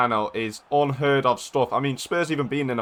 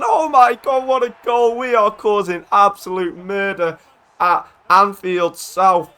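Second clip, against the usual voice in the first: ecstatic goal call; 5 semitones higher, 4 dB louder than usual. Real, not acted. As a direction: build to a shout as the goal goes in, then savour it. Commentates own goal as it happens.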